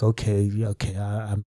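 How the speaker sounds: very soft, low, faltering acceptance; awkward hesitation. Retold or imitated. Man answering the mother's excuse, awkward murmur. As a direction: back off quietly, stumbling on your words. Retells awkward reply to her explanation.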